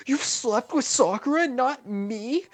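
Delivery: very high, loud betrayed cry; shocked Kakashi. Acted. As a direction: squeal in disbelief.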